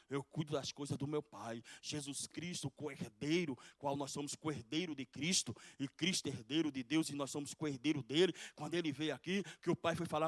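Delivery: loud pulpit preaching, driving each phrase; a fired-up sermon, pressing the point; preaching at full force. Acted. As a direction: preach it out to the congregation.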